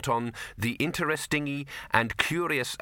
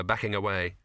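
plain reading voice

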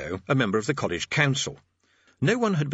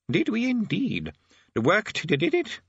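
put-on doddery old wizard, much higher; vague, wandering old man; 10 semitones higher, slower than usual. A narrator's reading. Old Arch-Chancellor speaks.

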